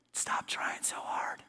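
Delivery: slow, breathy whisper of God's voice. Retold or imitated. Preacher voicing God to a tired believer, in a slow whisper. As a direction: hush it like a gentle secret.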